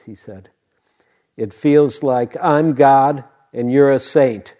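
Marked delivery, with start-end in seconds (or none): 1.4-4.5 booming, slow proclamation; sudden loud voice. Retold or imitated. Hospice patient answering how it feels, suddenly loud and slow. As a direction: imitate a patient suddenly declaring it at full volume. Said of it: "Literally in that voice".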